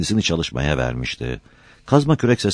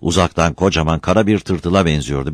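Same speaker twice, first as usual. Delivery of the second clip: usual speaking voice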